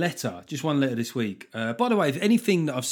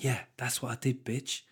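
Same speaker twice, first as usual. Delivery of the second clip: snide inner voice; 7 dB quieter than usual. Retold or imitated. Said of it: "They're like".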